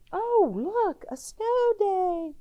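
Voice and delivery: high, slow, amazed; delighted wonder. Acted. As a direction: marvel at the snow like a child. Delighted show of a snow day.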